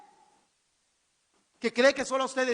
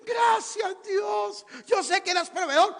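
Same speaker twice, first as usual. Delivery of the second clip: mock thankful shout; 8 semitones higher, 4 dB louder, slower than usual. Mocking thankful believer.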